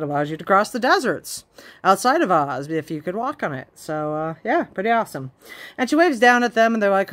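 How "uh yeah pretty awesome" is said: usual speaking voice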